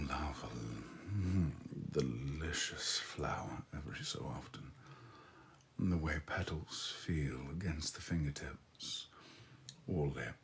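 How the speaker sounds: soft, lingering sensual murmur; sultry murmur. Acted. To flirting chat about flowers, a soft sensual murmur. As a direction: savor each word softly.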